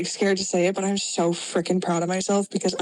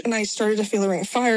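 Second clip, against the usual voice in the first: normal talking voice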